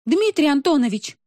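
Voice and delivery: high, loud indignant exclamation; exclaiming. A narrator's reading. Woman calling out the man in charge, loud high exclamation. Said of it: "воскликнула Роза Жановна".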